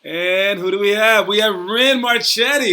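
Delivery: loud slow host intro; announcer. Acted.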